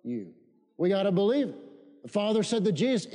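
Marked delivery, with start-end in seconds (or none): 0.0-0.3 call and response. Shown as a demonstration. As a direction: lead the church to echo, dragging each word. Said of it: "say nothing".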